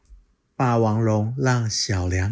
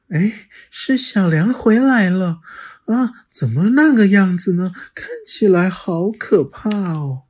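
higher, louder alarmed mother voice; surprised mother; 10 semitones higher, 7 dB louder than usual. Acted. Mother dinosaur seeing her child return, higher and alarmed. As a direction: gasp as a worried mother.

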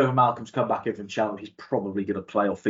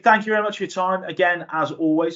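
podcast outro; 11 semitones higher than usual. Acted. Presenter outro to listeners.

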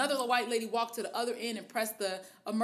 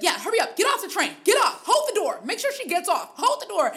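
much higher and louder impatient yelling; snapping passenger; 8 semitones higher, 7 dB louder than usual. Retold or imitated. Woman mimicking a passenger snapping at the lady, a high loud impatient yell. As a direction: snap at her to get off, high and loud.

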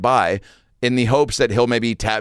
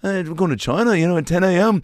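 higher, lightly Scouse voice; 8 semitones higher than usual. Retold or imitated. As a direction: brush it off breezily in a Liverpool lilt. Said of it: "And he's like".

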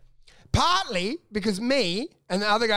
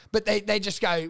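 normal talking voice

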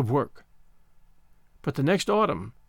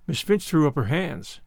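ordinary delivery

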